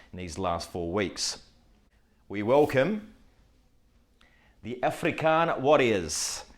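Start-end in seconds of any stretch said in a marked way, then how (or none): 2.3-6.4 a slow, light try at a South African accent. Shown as a demonstration. Host welcomes the new African division, in a mock South African accent. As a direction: drawl the team name in a South African accent. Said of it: "That was my best South African accent".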